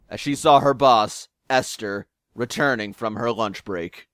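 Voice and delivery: ordinary talk, nothing marked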